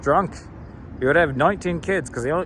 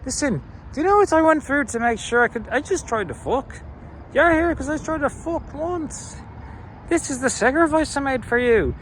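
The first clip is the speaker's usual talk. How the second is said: high-pitched, exasperated mock rant; ranting Irish parent; 11 semitones higher than usual. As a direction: lecture the kids in an aggrieved, pitched-up voice. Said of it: "your future kids are like".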